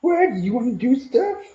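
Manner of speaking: very high squeaky voice, falling on each what; excited puppy talk. Acted. Owner baby-talking to his playful puppy, very high squeaky voice.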